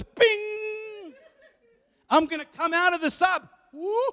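high-pitched, excited spirit waking up. Acted. Preacher voices the spirit popping up during sleep, very excited. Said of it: "He's very excited".

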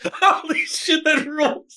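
laughing through the words, pitched high; cracking up. Real, not acted. Host reacting to a ridiculous cartoon line, cracking up. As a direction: gasp it out through helpless laughter.

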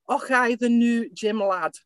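hammed-up cartoon Scots accent; caricature Scottish accent. Shown as a demonstration. Englishwoman showing how Scots supposedly sound, caricature Scottish accent. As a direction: put on a stock Scottish brogue. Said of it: "the caricature is like".

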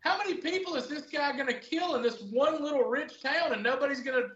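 high-pitched, exasperated disbelief; mock-baffled viewer rant; incredulous. Retelling disbelief at a show's plot.

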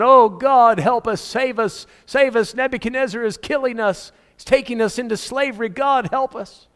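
high-pitched mock pleading with God; imitated desperate prayer. People praying under Nebuchadnezzar's attack, acted high pleading cry. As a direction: act out a people crying out for rescue. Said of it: "the people are praying and fasting".

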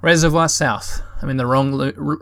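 usual speaking voice

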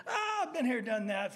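high laughing mock-confident quote; a chuckling imitated brag. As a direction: laugh through the boast. Laughing at own wishful thinking.

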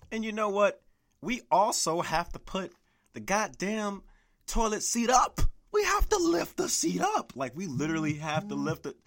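high, loud exasperated yell; ranting shout. Real, not acted. Fed-up guy ranting at women about lifting the seat. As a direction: build into an angry rant.

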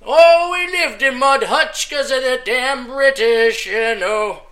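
put-on slow, mournful Irish-accented telling; hammed-up Irish sob story, slow; mock Irish lament. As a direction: drag it out like a sad Irish ballad. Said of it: "I'm talking about the sad, crappy".

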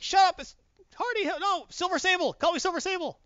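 very high squeaky hammed-up correction; mock heroic insistence. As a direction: squeak it out, insisting on the hero name. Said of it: "her team is yelling at her".

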